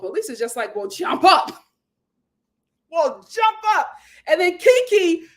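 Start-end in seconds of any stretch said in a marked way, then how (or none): 0.7-1.6 imitating a defiant dare, loud at the end; mock fighting words, rising finish; confrontational challenge. Retelling Lisa's challenge in quarrel. 2.9-3.9 higher-pitched mock dare; hammed-up squaring up for a fight; confrontational challenge. Repeats Lisa's challenge, acted quarrel.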